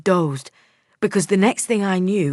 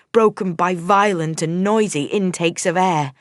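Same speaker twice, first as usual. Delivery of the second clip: ordinary delivery, nothing marked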